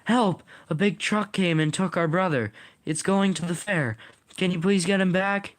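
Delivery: high-pitched cry for help; urgent yell. A narrator's reading. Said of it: "One yells".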